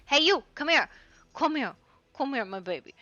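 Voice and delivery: calling out. Real, not acted. Calling the in-game dog over.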